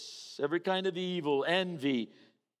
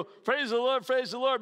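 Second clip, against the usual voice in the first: higher and louder, empty repeated praise; hollow praise; 8 semitones higher, 6 dB louder than usual. Retold or imitated. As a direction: chant it without meaning it. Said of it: "you walk away and you go".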